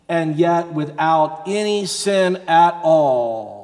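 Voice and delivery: ordinary talk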